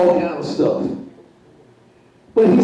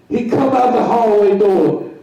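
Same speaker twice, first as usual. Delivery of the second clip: ordinary talk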